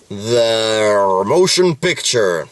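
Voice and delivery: announcer voice. Pretend. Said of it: "that's Star Trek".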